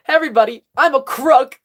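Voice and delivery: high, loud sarcastic proclamation; mock announcement. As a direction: call it out as if announcing to everyone. Quoted mocking sign, called out.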